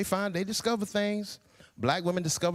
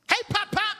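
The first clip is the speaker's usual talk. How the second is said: put-on high squeaky kid voice calling out to grandpa; bright piping call of a small child; 13 semitones higher than usual. Little grandkid greeting grandpa with a high call. Kids calling him names.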